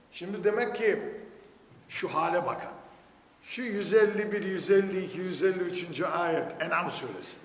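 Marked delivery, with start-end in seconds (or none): none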